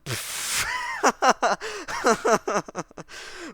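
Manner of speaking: loud, high roaring laugh; great bellow of laughter. A narrator's reading. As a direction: throw the head back and roar with laughter. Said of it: "a great bellow of laughter".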